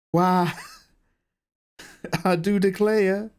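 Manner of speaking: hammed-up Southern American accent through laughter. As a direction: drawl it like a mock Southern American. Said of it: "that is the best American accent there is".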